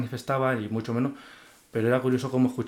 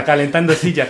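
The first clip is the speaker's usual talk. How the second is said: loud laughing admission; 4 semitones higher, 11 dB louder than usual. Real, not acted. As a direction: say it while cracking up, louder. Joking about warming the seat.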